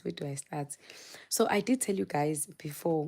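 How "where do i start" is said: whispered repeat to herself. Real, not acted. To herself before starting the story, whispered. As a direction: drop to a whisper and ask it again. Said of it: "repeated in a whisper".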